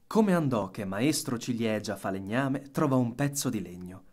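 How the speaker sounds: ordinary delivery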